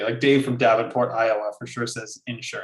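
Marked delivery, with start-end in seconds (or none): 2.3-2.6 INSURANCE stressed on the first syllable; Midwest IN-surance. Retold or imitated. Quoting a Midwesterner from Iowa, stressing the IN. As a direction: put the weight on IN in INSURANCE. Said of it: "I think Midwest folks say".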